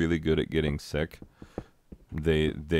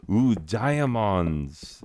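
surprised gasp; 5 semitones higher, 3 dB louder, much slower than usual. Real, not acted. Finds diamonds in game.

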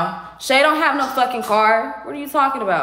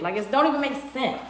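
fast, softer, thrown away; quick dismissal; 6 dB quieter, faster than usual. Real, not acted. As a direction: toss it off quickly under the rant. First take, said in passing.

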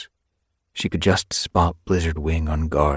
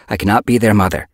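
briskly; 4 semitones higher, 8 dB louder, faster than usual. A narrator's reading. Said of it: "Although she spoke briskly".

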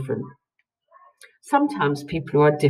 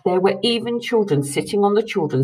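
ordinary delivery

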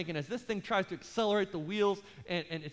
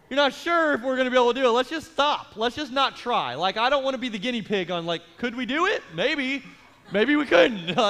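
louder, higher mock panic at the pilot; panicky protest; 3 semitones higher, 8 dB louder than usual. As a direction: beg to get off the plane, half joking. Panicky passenger answering the pilot.

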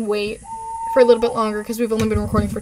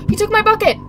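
higher and louder, real shock; outraged surprise; 9 semitones higher, 7 dB louder than usual. Real, not acted. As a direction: yelp it out, robbed out of nowhere. Her bucket suddenly stolen.

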